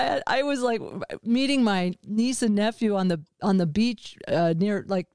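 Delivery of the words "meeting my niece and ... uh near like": normal talking voice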